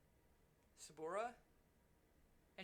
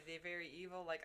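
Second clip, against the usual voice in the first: ordinary talk, nothing marked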